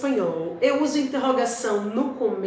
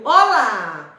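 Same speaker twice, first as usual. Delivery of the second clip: louder, bright exclaimed greeting; exclamation; 2 semitones lower, 6 dB louder than usual. Shown as a demonstration. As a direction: call it out as an exclamation. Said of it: "não é um exclamativo isso aí?". ("Olá!" exclamado, mais alto)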